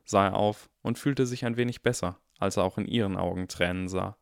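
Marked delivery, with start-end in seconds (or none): none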